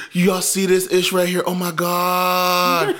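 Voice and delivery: high, loud gawking, the last words drawn out slow; excited livestreamer. Retold or imitated. Sister filming the bodies across the street, high excited mock. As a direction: gasp at the scene for your viewers. Sister narrating live stream.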